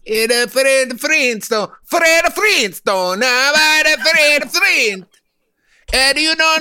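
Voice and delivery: high, slow, shouted fake Italian; loud Italian accent. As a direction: belt it out like an opera Italian. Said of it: "your loud gibberish Italian guy".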